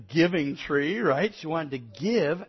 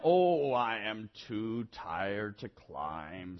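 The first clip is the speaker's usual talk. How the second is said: very slow, low, soft groaning voice; tired old man; 5 semitones lower, 4 dB quieter, much slower than usual. A narrator's reading. Old boy too tired to climb, worn out. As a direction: stretch each word with exhaustion.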